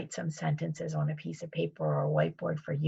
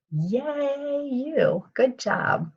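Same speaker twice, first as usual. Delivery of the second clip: cheerful praise; 8 semitones higher, much slower than usual. Real, not acted. Praising children for correct sentence.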